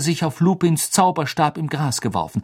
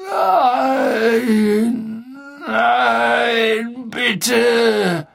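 anguished cry; 11 semitones higher, 4 dB louder, much slower than usual. Acted. Sirius facing the Dementors.

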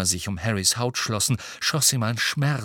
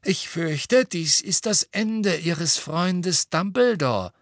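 slow, higher-pitched gloating; spiteful; 6 semitones higher, slower than usual. A narrator's reading. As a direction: draw it out with malicious relish. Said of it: "sagte Fudge gehässig".

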